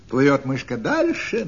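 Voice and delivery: usual speaking voice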